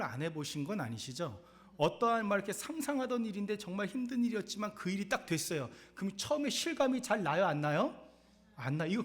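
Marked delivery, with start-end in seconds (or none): 1.8-9.0 animated preaching. Acted.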